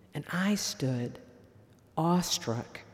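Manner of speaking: usual speaking voice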